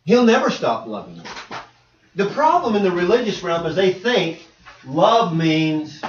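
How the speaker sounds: ordinary talk, nothing marked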